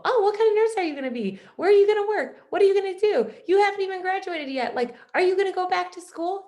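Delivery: high, loud, rapid-fire pestering questions; nosy questioner. Retold or imitated. People quizzing a new nursing student, nosy and high-pitched.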